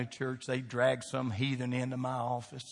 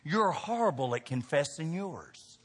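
ordinary talk

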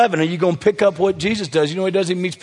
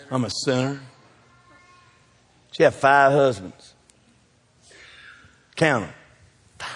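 ordinary talk, nothing marked

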